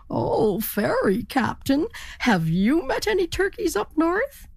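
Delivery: high, lilting turkey question; eager turkey. A narrator's reading. Turkey hails the ferry captain, high questioning. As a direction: call up high, stretching the name. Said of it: "he asked".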